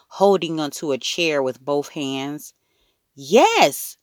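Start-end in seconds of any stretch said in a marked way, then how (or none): 3.2-4.0 put-on high, pleased little-boy yes; beaming. A narrator's reading. Toddler Fudge confirming to his mother, beaming. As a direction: answer proudly in a raised voice. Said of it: "Fudge beamed".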